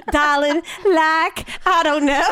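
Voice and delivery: a teasing hint of a Southern belle accent; imitating Southern belle. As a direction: mimic her Southern drawl for a laugh. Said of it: "imitating S1's Darling".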